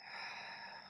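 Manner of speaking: long soft exhale through the mouth; breathing-out demo. Shown as a demonstration. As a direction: show the out breath. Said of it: "as you're doing the breath".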